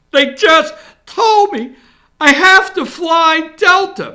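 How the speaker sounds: high, loud, slow whiny cry; mock wailing senator. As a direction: wail it like a spoiled wife. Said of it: "Why are you crying".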